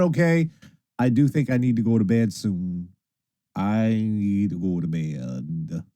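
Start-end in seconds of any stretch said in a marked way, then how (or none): 3.5-5.8 low, very slow and drawn out; sleepy drawl. Real, not acted. Streamer admits bedtime is due, sleepy drawl. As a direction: drag the words out as if nodding off.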